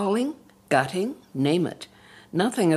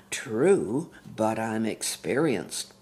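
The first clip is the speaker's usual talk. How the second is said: purring; 6 semitones lower than usual. Acted. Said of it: "purrs Harry".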